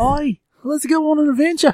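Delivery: put-on very high, squeaky Aussie accent; baby Australian. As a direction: pipe it up like a tiny Australian. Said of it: "basically a baby Australian".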